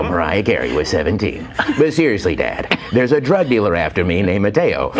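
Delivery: mock radio-host son pleading with dad; J C Kasem. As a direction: plead melodramatically in a swooping DJ cadence. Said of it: "And I go".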